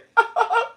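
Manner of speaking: high-pitched wheezy laugh; helpless high laughter; real laughing fit. Real, not acted. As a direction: keep cracking up. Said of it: "I'm crying".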